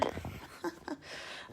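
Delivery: short breathy real laugh; embarrassed laugh. Real, not acted. Crafter laughing at shutting her screen by accident, short laugh. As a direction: laugh off a small mishap.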